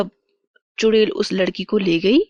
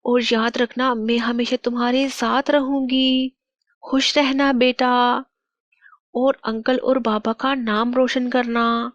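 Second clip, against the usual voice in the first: higher-pitched, wistful mother's spirit; loving mother's farewell; 4 semitones higher than usual. Acted. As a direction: bid your child a tender goodbye. Mother's last visit to her child. (बच्चे से विदा लेती माँ, ममता भरी)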